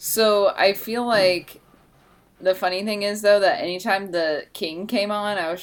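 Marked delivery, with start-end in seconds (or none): none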